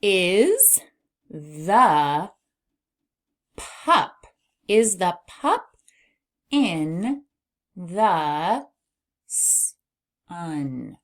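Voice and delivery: each word separate, long pauses between; word-by-word reading. Shown as a demonstration. Teacher decodes a sentence for beginners, word by word. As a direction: sound out the sentence one word at a time. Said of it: "All right, now we can read".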